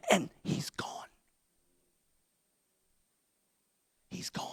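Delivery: whispered, almost silent; breathless hush over an empty tomb. Acted. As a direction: let it fall to a stunned whisper.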